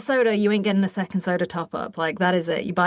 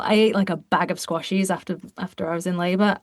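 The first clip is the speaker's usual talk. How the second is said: usual speaking voice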